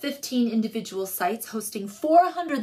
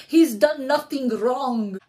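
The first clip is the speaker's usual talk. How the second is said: slow, louder, hammed-up Eastern European accent; a bad fake Eastern European accent, drawn out; mock Eastern European accent; 2 semitones higher, 4 dB louder, slower than usual. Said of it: "in an incredibly believable accent".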